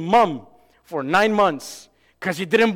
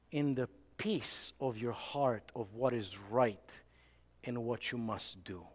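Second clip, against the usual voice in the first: hushed close; 7 semitones lower, 13 dB quieter than usual. Real, not acted. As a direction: bring it down to a near whisper. Closing quiet exhortation.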